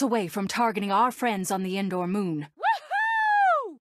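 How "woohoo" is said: very high, long cheer; exhilarated high-pitched woohoo; elated whoop. Acted. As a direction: whoop with joy. Victory whoop.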